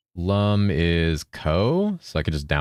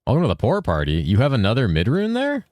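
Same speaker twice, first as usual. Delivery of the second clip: normal talking voice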